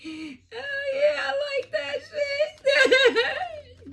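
high, loud, slow whooping laugh; real delighted laughing shout. Real, not acted. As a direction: crow with delight. Delighted by the reading.

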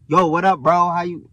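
punchy, sociable street hello; chatty guy greeting. Retold or imitated. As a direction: hail him like a talkative stranger. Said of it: "they like".

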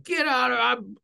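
half-hearted imitation of barking orders; retold yelling. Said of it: "I start yelling at him".

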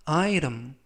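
"item" in two syllables, weak ending; English-style pronunciation. Shown as a demonstration. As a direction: say "item" American-style, T turned to a light R. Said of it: "이라고 발음하시면 더 좋겠습니다".

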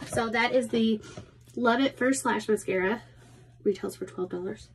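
ordinary delivery

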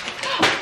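sudden high shocked gasp; sharp gasp. Real, not acted. Vlogger gasping after nearly breaking a candle. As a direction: gasp in real alarm.

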